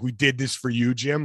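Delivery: usual speaking voice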